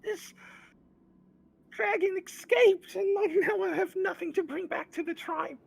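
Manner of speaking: very high, loud creature voice crying out; a sobbing little kobold begging for help; tearful kobold Meepo. Acted. Meepo the dragon tamer pleading with strangers, high tearful cry. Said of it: "through tears, uh, cries out and says".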